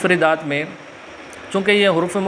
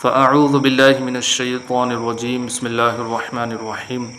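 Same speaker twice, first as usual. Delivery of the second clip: measured, lower recited invocation before a lesson; formal Islamic opening; 4 semitones lower than usual. Acted. Teacher opening his Qaida lesson with salutations, recited.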